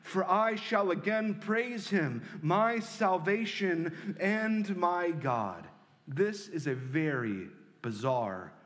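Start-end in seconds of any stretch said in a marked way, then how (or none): 0.0-5.7 slow, rising preacher's reading of the psalm; solemn scripture reading. Acted. Pastor reading the psalm to the congregation, slow and solemn. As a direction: lean into each line like a sermon text.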